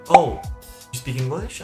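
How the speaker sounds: ordinary delivery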